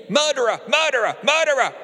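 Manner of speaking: shrieked high and loud; screamed accusation. Acted. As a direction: scream it at him like an accusing chorus. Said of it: "they screamed at me".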